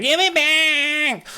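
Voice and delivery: high, slow, whiny nerd voice; Kermit whine. Retold or imitated. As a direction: whine it like a nerdy kid. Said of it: "And Kermit's just like".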